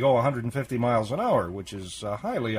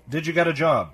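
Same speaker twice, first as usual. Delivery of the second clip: repeating louder; 3 semitones higher, faster than usual. Real, not acted. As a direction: say it again so he hears.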